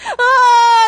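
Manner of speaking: high, loud mock baby cry; sudden drawn-out imitation bawl; imitated baby wail. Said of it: "the baby just crying right away".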